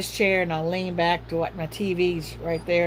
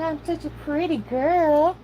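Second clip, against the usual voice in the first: put-on high, slow baby voice; voicing the dog; 8 semitones higher than usual. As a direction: give the dog a high little voice.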